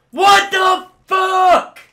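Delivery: very loud, high, slow outrage; screamed rage. Real, not acted. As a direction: scream it at the top of your voice. Gamer fails again.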